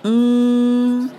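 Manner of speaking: 嗯 as a long, high ng hum; sustained ng sound; back nasal. Shown as a demonstration. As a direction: hold 嗯 steady in the back of the nose. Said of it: "是后鼻音".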